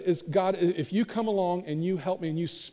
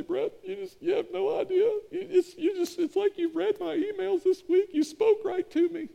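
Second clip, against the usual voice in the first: much higher put-on voice of a moved churchgoer; tearful congregant; 12 semitones higher than usual. Churchgoer thanks the pastor after the sermon, high and teary. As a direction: lift way up into a teary listener's voice. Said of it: "somebody will walk up in tears".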